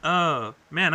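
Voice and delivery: usual speaking voice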